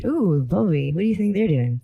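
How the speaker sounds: mock dazed stoner. Pretend high friend, dazed.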